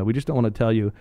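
ordinary talk

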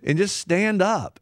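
rally them to stand; loud exhortation. Real, not acted. Host urging students to stand up, loud and slow.

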